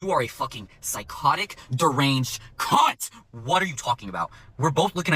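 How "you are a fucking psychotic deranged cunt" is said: low, slow, drawn-out contempt; deliberate, emphatic disgust; scornful insult. Retold or imitated. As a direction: spell out the insult word by word. Server's insult after tirade.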